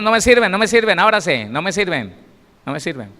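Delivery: dismissive Jesus. Acted. Jesus pushing out the doubters, loud then fading. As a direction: wave them all away. Jesus sending the others away.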